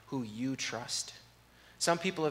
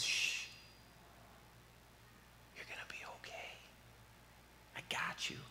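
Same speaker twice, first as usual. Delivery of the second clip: put-on hushed whisper, like calming a scared child; soothing whisper; 13 dB quieter than usual. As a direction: breathe it softly, all air, no voice. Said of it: "just says".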